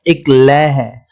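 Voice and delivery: normal talking voice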